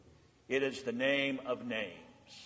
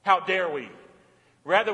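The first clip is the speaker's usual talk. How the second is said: ordinary talk, nothing marked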